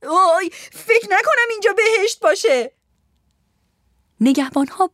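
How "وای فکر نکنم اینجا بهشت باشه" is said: high-pitched distressed shout; yelling. Acted. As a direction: cry out in alarm. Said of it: "لنا با داد و فریاد گفت". (با داد و فریاد)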